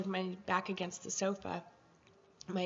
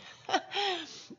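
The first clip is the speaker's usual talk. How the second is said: flustered laugh; 12 semitones higher than usual. Real, not acted. Flustered during blessing.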